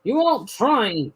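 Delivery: slow, firm telling-off; severe scolding. A narrator's reading. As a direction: scold the engine sternly. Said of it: "talking to him severely".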